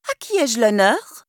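plain reading voice

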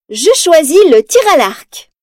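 high, loud and cunning; sly exclamation. Acted. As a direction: say it with a crafty grin. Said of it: "s'exclame Mérida, un sourire rusé".

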